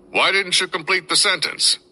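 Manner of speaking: high accusing yell; an officer yelling across the room. A narrator's reading. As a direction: shout it at him, shaking the paper. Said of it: "he yelled at me".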